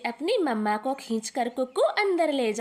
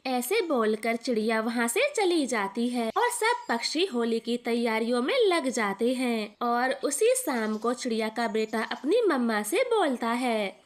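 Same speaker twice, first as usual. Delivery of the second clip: usual speaking voice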